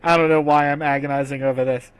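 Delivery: usual speaking voice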